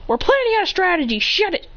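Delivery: high, quick, irritated comeback; acted annoyed outburst, high and hurried; annoyed retort. Pair snap back at Sasuke.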